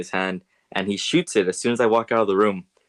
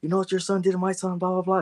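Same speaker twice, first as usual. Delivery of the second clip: imitated yelling dad; 10 semitones higher than usual. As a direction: act out a father's tirade in raised pitch. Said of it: "yelling at his parents".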